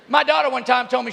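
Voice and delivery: normal talking voice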